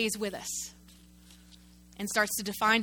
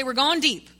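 call and response; 5 dB louder than usual. Shown as a demonstration. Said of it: "everybody say".

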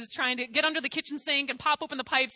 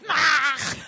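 loud exasperated "aah"; mock frustrated groan; 2 semitones higher, 8 dB louder than usual. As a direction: let out a loud, hammed-up cry of frustration. Imitates own frustrated outburst to mom.

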